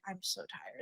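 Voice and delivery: a soft, drained mumble, barely voiced; mock tired mumble. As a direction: imitate the daughter sounding worn out and sleepy. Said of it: "And then Ray's like".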